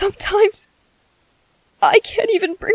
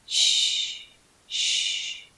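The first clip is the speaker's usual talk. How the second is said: unvoiced hushing; soft hush; 11 dB quieter than usual. Parent hushing a crying baby, softly. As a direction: gently shush a baby to sleep.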